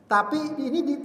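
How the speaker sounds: ordinary talk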